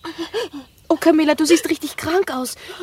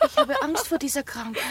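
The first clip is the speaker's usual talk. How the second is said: soft, tearful worry; tearful fear; 4 semitones higher, 5 dB quieter than usual. Acted. Laura frightened for sick Camilla, tearful. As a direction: close to crying with fear.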